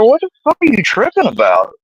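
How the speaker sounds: shrill-high disbelieving yelp, voice jumping way up; sudden high exasperated cry; high-pitched outburst. Retold or imitated. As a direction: squeal it up high in outraged disbelief. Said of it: "I was like".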